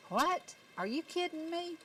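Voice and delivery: baffled, disbelieving cry; staring at the day's mess in disbelief. Retold or imitated. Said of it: "scratching my head going".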